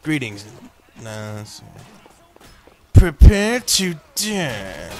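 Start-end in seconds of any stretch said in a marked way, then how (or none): 2.9-4.9 slow, loud, higher hammed-up warrior threat; mock warrior taunt. Gamer challenging a foe, slow hammed-up warrior taunt. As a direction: draw it out like a movie villain.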